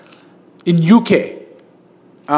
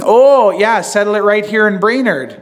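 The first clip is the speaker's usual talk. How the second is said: put-on Fargo accent; 4 semitones higher, 3 dB louder than usual. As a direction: quote Fargo in its Minnesota twang.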